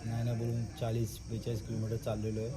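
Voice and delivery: soft, low night talk; quiet at night. Real, not acted. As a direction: speak softly so nobody wakes. Late night, speaking softly near sleepers.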